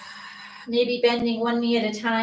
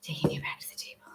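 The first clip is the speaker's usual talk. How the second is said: whispered aside; 12 dB quieter, much faster than usual. Real, not acted. Aside while moving the camera.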